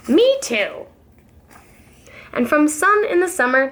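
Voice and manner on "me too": put-on high little kid's voice; higher-pitched baby animal voice; young kangaroo. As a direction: chime in like a small child. Young kangaroo in pouch speaks.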